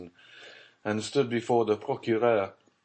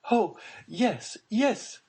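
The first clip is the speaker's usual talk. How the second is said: rising high, hopeful cry; eager cry; 14 semitones higher, much slower than usual. Acted. Baroness seizing on news of the hospital, high eager cry. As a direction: grab at the hope. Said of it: "cried the Baroness".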